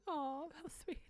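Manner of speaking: high, soft touched coo. Retold or imitated. Recalling being handed crisps, touched coo. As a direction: imitate yourself melting, high and soft. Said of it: "me being like".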